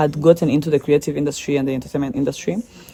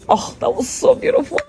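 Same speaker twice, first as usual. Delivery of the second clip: thrilled gush. Real, not acted. Recalling the joy of finishing.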